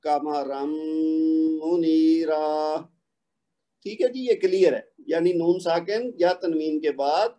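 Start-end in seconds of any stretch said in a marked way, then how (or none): 0.1-2.5 قمرا منیرا recited very slowly with long nasal ghunna; drawn-out recitation. Shown as a demonstration. Teacher recites the example slowly for students.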